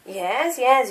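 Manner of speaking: ordinary talk, nothing marked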